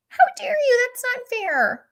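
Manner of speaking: put-on high, loud outraged teen whine; indignant teen daughter. Daughter protesting her mom, high indignant whine. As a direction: play the wronged teenager. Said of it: "my daughter's reaction".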